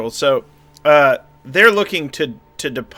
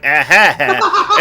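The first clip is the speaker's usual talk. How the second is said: awkward laugh; 2 semitones higher, 5 dB louder, slower than usual. Acted. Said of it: "Mitt Romney level gaffe".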